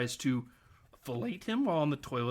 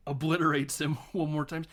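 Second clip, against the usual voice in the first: ordinary talk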